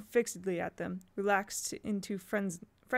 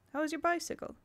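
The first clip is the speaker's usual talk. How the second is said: "How is your bicycle?" lifted higher; higher retake; 8 semitones higher, faster than usual. Shown as a demonstration.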